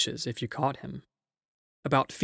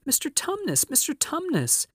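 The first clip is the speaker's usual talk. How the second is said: put-on very high, distressed child's cry; great distress; 14 semitones higher than usual. A narrator's reading. Lucy to the howling faun, in great distress. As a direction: plead his name in a high, distressed voice. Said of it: "said Lucy in great distress".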